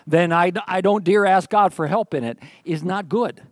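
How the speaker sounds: normal talking voice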